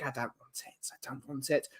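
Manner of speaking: disgruntled fan grumbling about the heat; muttered refusal. Real, not acted. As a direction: say it under your breath. Rejecting the heat wave.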